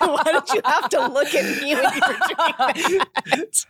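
laughing through the protest. Real, not acted. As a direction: laugh while you complain.

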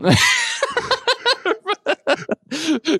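high shrieking burst of laughter. Real, not acted. Reacting to co-host's joke with a high shrieking laugh. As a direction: cackle high and long.

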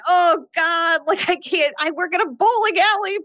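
high, shouted, pleading panic; panicked pleading. Retold or imitated. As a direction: beg them to leave you out of it, voice up high. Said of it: "And Sam's like".

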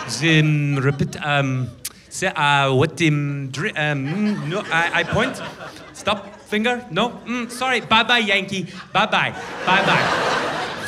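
put-on halting English in a French Canadian accent. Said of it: "the French Canadian will go".

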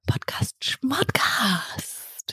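slow breathy whisper. Pretend. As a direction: whisper it slowly, drawn out. Geflüsterte Antwort, sehr langsam. (langsam geflüstert)

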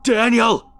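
high, loud call torn from the throat; desperate scream. A narrator's reading. Runner being clawed calls to Daniel, torn scream. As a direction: scream the name for help. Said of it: "words were being torn from my throat".

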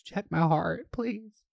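high, slow, pleading voice fading to a whimper; panicking, begging her friend to check her heart; panicked plea. Retold or imitated. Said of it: "And I'm like".

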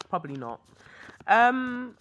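ordinary talk, nothing marked

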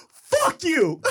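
loud, high-pitched mock fury; angry outburst. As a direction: hurl it as a furious retold yell. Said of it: "she looks at me and she goes".

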